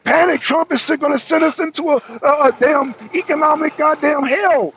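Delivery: high, loud mock panic cry; mock alarmist news. Mocking the news media telling people to panic, loud and high. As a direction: shout it like hysterical TV news. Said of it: "And the news media said".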